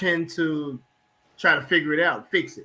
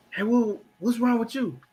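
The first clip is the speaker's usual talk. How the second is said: higher, questioning partner voice; prying partner; 7 semitones higher than usual. Retold or imitated. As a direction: lift up into a nosy, concerned partner. Wife questioning quiet husband.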